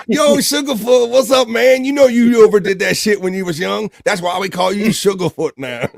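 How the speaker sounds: playful high-pitched teasing; teasing greeting. Acted. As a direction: rib an old friend in a high put-on voice. Acting out someone greeting Sugarfoot.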